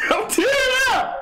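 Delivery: laughter building into a high squeal; laughing to tears. Real, not acted. Streamer tearing up with laughter, breaking into squeals. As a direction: crack up until it becomes a squealed shout.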